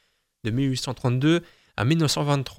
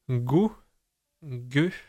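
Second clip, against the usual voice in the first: Goguettier stretched out very slowly, puzzled; 3 dB quieter, much slower than usual. Real, not acted. Mulling over an odd word to viewers, slow and puzzled. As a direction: drag the strange word out, puzzled. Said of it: "un truc qui m'a paru bizarre".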